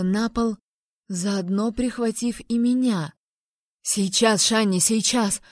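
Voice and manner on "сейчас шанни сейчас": ordinary delivery, nothing marked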